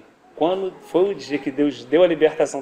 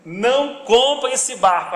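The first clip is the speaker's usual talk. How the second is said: slow, loud, higher-pitched command; emphatic divine warning; 5 semitones higher, 7 dB louder, slower than usual. Acted. God repeating a warning to a brother, louder and drawn out. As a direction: drive the warning home, loud and stretched. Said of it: "que Deus falou assim".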